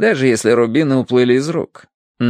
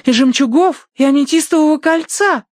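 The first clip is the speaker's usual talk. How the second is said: put-on high, loud girlish wail; distressed girl; 5 dB louder than usual. A narrator's reading. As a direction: pile on each loss in alarm.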